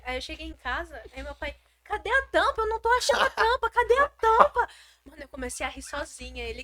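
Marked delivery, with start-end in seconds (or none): 1.8-3.7 loud, high frantic dad yelling for the lid; dad calling through the house for the missing lid, loud and frantic. Retold or imitated. 3.7-4.7 "cadê a tampa" yelled high and loud; "cadê a tampa" yelled by a dad, high and loud; loud call. Retold or imitated. Dad searching loudly.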